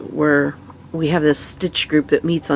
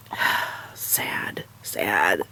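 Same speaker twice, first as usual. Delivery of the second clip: sighing, whispered sad, sad; 10 semitones lower, 8 dB quieter, much slower than usual. Real, not acted. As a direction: breathe it out, defeated and tired. Sleepless night lament.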